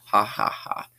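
airy, voiceless villain chuckle; breathy evil laugh. A narrator's reading. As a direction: laugh under the breath, menacing. Killer laughs after confession.

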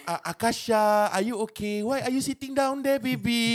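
high, slow mock parent cooing; parent teaching English. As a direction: coo at a child in halting English. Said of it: "whenever I see a parent trying to teach a kid in English".

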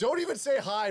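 high, loud laughing plea. Real, not acted. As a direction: say it while cracking up. Laughing at guest.

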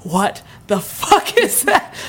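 slow, drawn-out disbelief; retold disbelief. Telling friends about the thing on the ceiling, stunned. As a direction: stretch each word in stunned disbelief. Retelling seeing something fly overhead.

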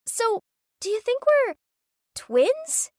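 high, eager question; excited. Acted. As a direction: ask it bursting with excitement. Gabby asks excitedly.

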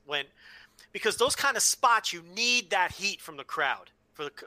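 normal talking voice

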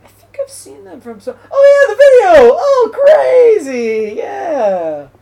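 high, loud excited shout; excited recognition. Retold or imitated. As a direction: yell it like spotting a friend. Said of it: "and I was like".

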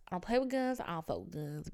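normal talking voice

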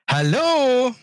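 high bright German hello; hello with German lilt; German accent. Pretend. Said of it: "I have to say".

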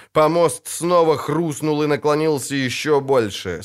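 plain reading voice

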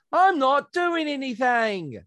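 high, drawn-out mock protest; exaggerated high-pitched imitation; mimicking the player. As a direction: mimic the player in a squeaky stretched-out whine. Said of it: "You just went".

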